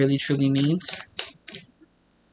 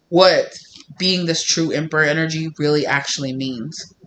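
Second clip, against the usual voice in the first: ordinary talk, nothing marked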